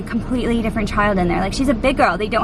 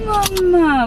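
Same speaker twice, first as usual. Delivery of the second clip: put-on toddler voice; 8 semitones higher than usual. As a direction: imitate a small child calling out. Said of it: "she went".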